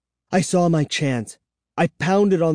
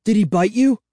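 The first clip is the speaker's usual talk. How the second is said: high, anxious question; choked-out question; 6 semitones higher, 4 dB louder than usual. A narrator's reading. Child turning to Kara after the attack, anxiously choked out. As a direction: ask it in rising alarm. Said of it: "I choked out".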